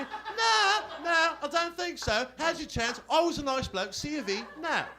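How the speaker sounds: high, loud put-on bloke turning her down; slow, smug no; smug refusal. Bloke turns down his ex on the phone, smug and high.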